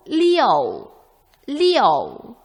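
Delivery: 리오 slow, with a falling Mandarin fourth tone; 리오 drawn out and falling like liù. Shown as a demonstration. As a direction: say 리오 slowly, dropping the tone. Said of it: "사성으로".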